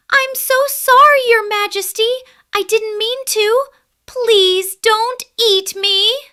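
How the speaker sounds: very high, loud, squeaky mouse voice; tiny mouse begging the lion, squeaky and scared; frightened mouse squeak. Acted. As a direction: squeak it out like a terrified little mouse. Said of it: "Mimi squeaked in fright".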